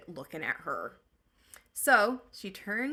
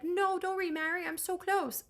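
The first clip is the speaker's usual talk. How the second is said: mock whiny plea; 11 semitones higher than usual. Imagined upset Odysseus.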